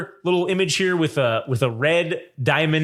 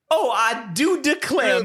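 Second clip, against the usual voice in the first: high, slow Southern belle drawl; hammy Southern genteel lady voice; Southern gentleman drawl; 8 semitones higher, slower than usual. Retold or imitated. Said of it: "He's like".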